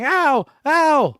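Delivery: high, loud yelps of pain; mock scream. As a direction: yelp like a man in a wrist lock. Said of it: "the newscaster's, like, screaming".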